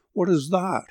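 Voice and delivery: frightened cry, high-pitched; a scared child hearing owls at night; cried in terror. A narrator's reading. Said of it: "he cried in terror".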